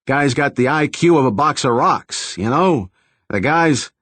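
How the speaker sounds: mock tough New York guy; hammed-up New York accent impression; New York City accent. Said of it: "spoke in a bass voice with a New York City accent".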